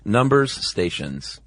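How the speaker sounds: Numbers stations said slowly, hitting the plural s of Numbers; pronounced numbers stations. Shown as a demonstration. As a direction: lean on the s in Numbers, slow and deliberate. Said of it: "Both words are pluralized".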